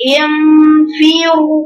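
انفروا drawn out very slowly with a nasal ن; slow recitation. Shown as a demonstration. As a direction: stretch the ن of انفروا long and slow. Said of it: "انت عندك المد غلط".

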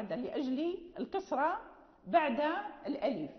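usual speaking voice

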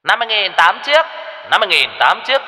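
cao giọng rao hàng qua loa, nhanh; shrill loudspeaker hawker. A narrator's reading. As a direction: rao bán quần áo như loa phóng thanh. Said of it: "kêu lên choe choé". (giọng rao loa chợ cao và vội)

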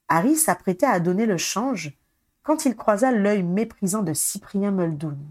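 usual speaking voice

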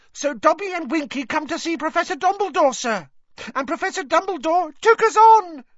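very high, loud, excited elf; Dobby shouting shrilly. A narrator's reading. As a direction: tell it shrilly over someone's screeching, building to triumph. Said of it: "shouting shrilly over Winky's screeches".

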